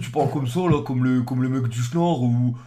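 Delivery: lightly imitated northern French accent; accent-free speech demo. Shown as a demonstration. Southerner shows how to drop the accent, mock northern French. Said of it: "virer ton accent".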